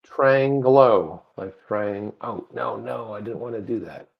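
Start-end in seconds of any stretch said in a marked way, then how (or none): 0.1-1.2 Triangelo stretched out very slowly; slow demonstration. Shown as a demonstration. Co-host shows host how the name goes, very slowly. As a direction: draw out each syllable of Triangelo.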